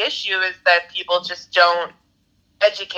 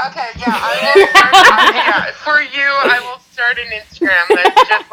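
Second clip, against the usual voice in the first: louder and higher through laughter; laughing; 6 semitones higher, 6 dB louder than usual. Real, not acted. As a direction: talk while laughing hard. Laughing at the recipe idea.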